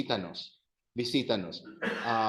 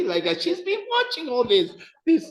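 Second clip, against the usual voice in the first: excited; 11 semitones higher, 8 dB louder than usual. Real, not acted. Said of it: "excited teacher".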